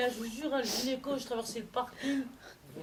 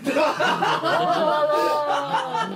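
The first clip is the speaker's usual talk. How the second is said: slow, loud laughing oh là là; amused loud laughter; 4 semitones higher, 9 dB louder, much slower than usual. Real, not acted. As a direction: let the laugh roll out. Laughing at [name].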